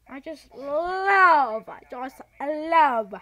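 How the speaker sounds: "love" stretched long and loud; sarcastic gushing. Real, not acted. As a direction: draw it out in mock delight. Mock delight at getting beaten.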